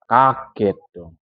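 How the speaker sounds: ordinary delivery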